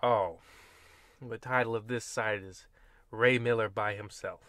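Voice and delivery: usual speaking voice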